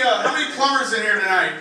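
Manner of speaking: ordinary talk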